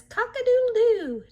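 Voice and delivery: high, loud cock-a-doodle-doo; playful rooster call for kids; rooster crow. Pretend. Imitating rooster in story.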